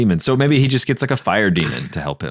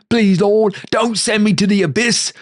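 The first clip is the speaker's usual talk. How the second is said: pleading Cockney henchman; 3 dB louder than usual. Acted. Henchman begs the Lord again.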